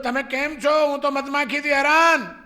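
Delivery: high, loud call across the distance. Retold or imitated. Monk shouting his bee trouble across the jungle, loud call. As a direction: shout the complaint to a far friend.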